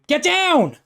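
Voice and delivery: urgent high shouted warning; loud yell. Acted. Unseen rescuer yells from behind the party, urgent shout. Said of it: "you suddenly hear a loud voice yell".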